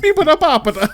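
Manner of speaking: hammed-up cartoon Italian; Italian accent. Caesar calling to Joseph, mock Italian accent. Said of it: "some dude doing an accent".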